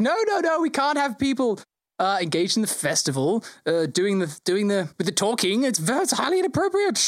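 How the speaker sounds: the GM voicing the Lord Mayor, high and fussy. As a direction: pitch it way up like a flustered dignitary.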